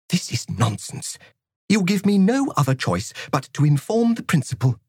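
cold, stern schoolmistress; strict teacher voice. Acted. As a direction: severe disciplinarian laying down the law. Narrator voices Miss Frost.

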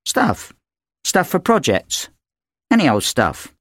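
put-on evasive girl. A narrator's reading. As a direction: shrug off the question. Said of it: "daughter evades mother".

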